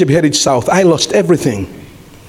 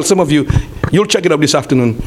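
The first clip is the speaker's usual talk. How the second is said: ordinary talk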